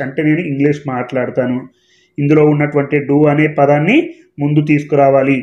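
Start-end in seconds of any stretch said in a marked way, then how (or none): none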